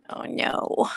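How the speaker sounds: dismayed whisper under breath. Real, not acted. Frozen video, dismayed aside.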